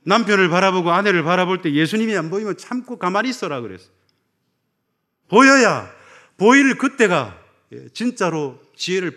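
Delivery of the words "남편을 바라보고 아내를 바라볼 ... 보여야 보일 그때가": louder, higher urgent exhortation; fervent preaching. Acted. Advising couples from the pulpit, loud urgent preaching. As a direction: drive the point home, slowing at the end.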